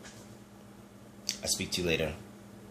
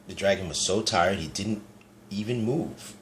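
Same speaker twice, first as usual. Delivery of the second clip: ordinary delivery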